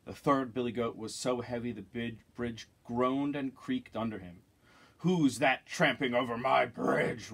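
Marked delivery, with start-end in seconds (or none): 5.0-7.3 slow, loud troll roar. Acted. Troll challenging the biggest goat, loud slow roar. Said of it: "roared the troll".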